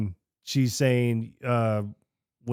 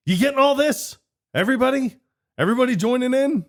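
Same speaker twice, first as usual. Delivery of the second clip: high, loud sarcastic tease; mocking loud tease; 13 semitones higher, 5 dB louder, faster than usual. Pete teasing the eavesdropping cellmates, high loud sarcastic tease. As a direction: needle the eavesdroppers, pitched way up.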